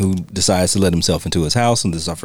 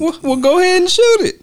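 much higher, daring taunt; mock Vera. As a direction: dare him, pitched way up. Vera dares Quick to shoot.

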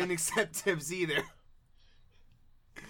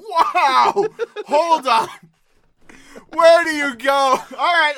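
loud laughing exclamation; 7 semitones higher, 11 dB louder, slower than usual. Real, not acted. Breaking scene with loud laughter.

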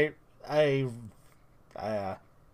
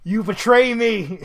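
laughing mock betrayal; 7 semitones higher, 7 dB louder than usual. Real, not acted.

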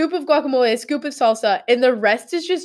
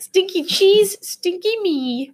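silly sing-song; 10 semitones higher, slower than usual. Pretend. Silly teasing chant about cheese.

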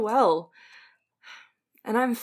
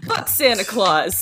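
laughing; 4 dB louder, slower than usual. Real, not acted. Player laughing at Santa.

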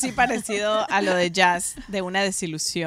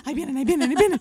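eager anticipation; 6 semitones higher, 3 dB louder, much faster than usual. Retold or imitated. Waiting for the waiters to sing.

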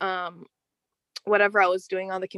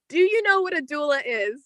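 ordinary talk

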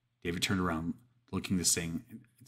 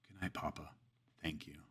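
much softer, gentle goodbye; tender farewell; 11 dB quieter than usual. Acted. Girl says goodbye to her dead demon papa softly. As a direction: whisper a last goodbye over his body.

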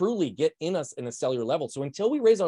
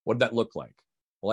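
ordinary talk

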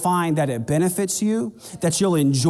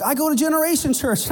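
high, bright imitation of a proud visitor; mimicking a churchgoer; 10 semitones higher than usual. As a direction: play the casual attender, much higher. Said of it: "they'll be like".

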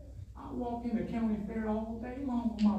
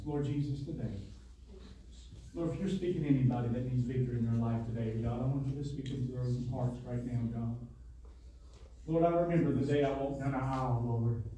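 prayer; 8 semitones lower than usual. Acted. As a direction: pray over the congregation. Closing prayer.

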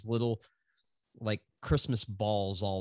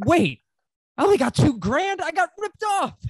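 loud, very high-pitched comic yell; mock outrage; 7 dB louder than usual. Guitarist learns of the boss's bonus, mock-outraged yell. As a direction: shout it like you've just been cheated.

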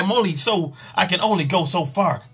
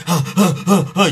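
mock panting; 2 semitones higher, 4 dB louder than usual. Panting while thrusting.

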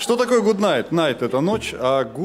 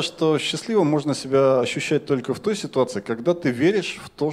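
ordinary delivery

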